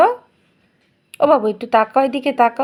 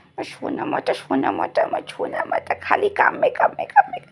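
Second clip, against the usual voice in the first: whispering; 7 semitones higher, 5 dB quieter than usual. Real, not acted. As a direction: whisper it close and teasing. Whispered baby talk to the child.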